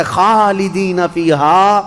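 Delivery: slow drawn-out Arabic phrase; Quranic recitation. Acted. The verdict declared to the damned, slow and intoned. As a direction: stretch each syllable of خالدین.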